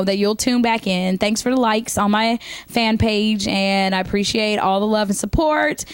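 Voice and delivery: ordinary talk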